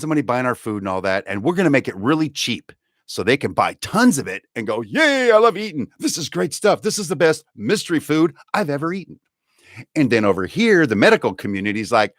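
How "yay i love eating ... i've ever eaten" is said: high, gushing fake enthusiasm; mock delight. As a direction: cheer like a hammed-up happy customer. Acting gleeful eaters of cheap food.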